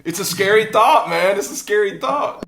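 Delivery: loud, high, laughing voice; laughing exclamation. Real, not acted. Listener reacting to friend's prediction of going vegan, laughing. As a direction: crack up while saying it, pitch shooting way up. Laughing along at the husband's story.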